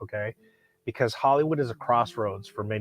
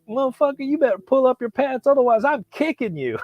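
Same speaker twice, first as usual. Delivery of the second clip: high-pitched hammed-up yell; mock yelling homeless man; 13 semitones higher, 4 dB louder than usual. Homeless man scolding a kid, high mock yell. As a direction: bark it like the angry street guy. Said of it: "And he's like".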